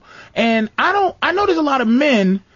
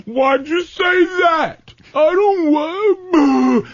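mock whining; 6 semitones higher, much slower than usual. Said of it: "I don't go".

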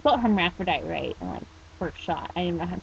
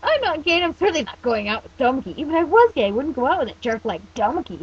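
raised, loud defensive protest; indignant cry; 6 semitones higher, 5 dB louder than usual. Acted. Teen denies dating a jerk he dislikes, indignant cry. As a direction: protest hotly, pitch jumping up. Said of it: "he cried, shaking his fist".

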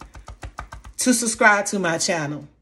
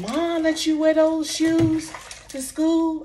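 higher, slow, lightly voiced brother; mock a kid brother's disbelief, drawn out; incredulous brother; 5 semitones higher, slower than usual. Brother's question, retold.

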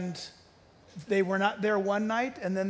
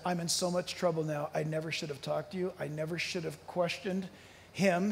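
softer, hushed, scared confession; fearful hush; 4 semitones lower, 6 dB quieter than usual. Retold or imitated. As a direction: voice a man terrified of his own words. Frightened man's last words retold.

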